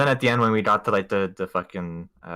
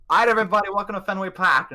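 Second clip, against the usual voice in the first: imitating a loud, higher, hearty Boston tour guide; 9 semitones higher, 3 dB louder, faster than usual. Friend imitating the Boston tour guide greeting the group, loud and hearty. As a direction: boom it out like a jolly Boston guide.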